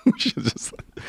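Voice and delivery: words squeezed out through laughter; high, voiceless laughing repeat; real laughter. Real, not acted. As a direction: laugh helplessly as you say it. Laughs at own regret.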